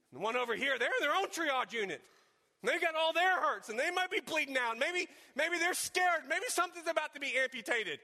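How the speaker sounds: high, loud preaching voice; fervent preaching. Acted. Preacher picturing the other wounded person, fervent preaching. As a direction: preach it out, pleading.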